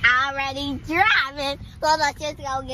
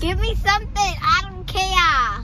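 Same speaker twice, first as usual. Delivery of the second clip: higher ratchet demand, slowing at the end; ratchet talk; 6 semitones higher, 4 dB louder than usual. Pretend. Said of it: "Stop talking like that".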